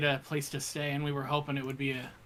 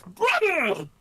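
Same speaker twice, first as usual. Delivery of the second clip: excited greeting; 11 semitones higher, 6 dB louder than usual. Acted. Greets goblin excitedly in character.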